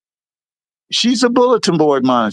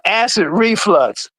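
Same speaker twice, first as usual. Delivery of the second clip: ordinary talk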